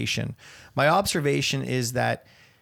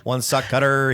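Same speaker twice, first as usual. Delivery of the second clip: ordinary talk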